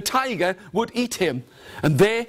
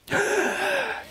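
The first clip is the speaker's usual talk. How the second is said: high, sharp theatrical gasp; acted gasp; 3 dB quieter than usual. Brahmin reacts to beggar's offer, high gasp. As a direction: gasp in high before answering.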